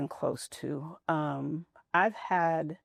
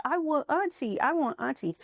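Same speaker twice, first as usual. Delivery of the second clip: mild imitation of a crying child, pitched high; high-pitched childlike plea, no real screaming; retold child's wail; 9 semitones higher than usual. Mother retells daughter missing auntie, mock child's wail. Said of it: "screaming and crying".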